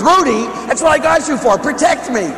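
high, loud comic plea; yelling at dog. Retold or imitated. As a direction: yell for the dog's protection in mock panic. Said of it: "I'm yelling at Ruby".

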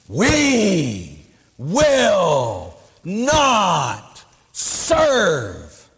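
very slow, drawn-out defiant proclamation; mock podium pounding. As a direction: stretch it out like a ranting speaker. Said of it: "she's pounding on the podium".

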